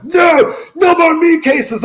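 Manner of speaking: high shouted wife protest. Retold or imitated. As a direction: yell it like a fed-up wife. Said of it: "My wife's like".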